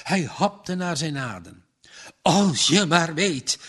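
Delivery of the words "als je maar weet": louder, sharp snap; snapping. A narrator's reading. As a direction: bite the words off in anger. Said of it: "snarpte hij". (vader snauwt boos terug)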